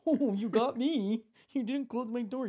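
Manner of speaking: mocking the man who left the door open; sarcastic. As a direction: sneer it at the half-closed door. Retold sarcastic reaction.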